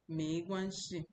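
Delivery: normal talking voice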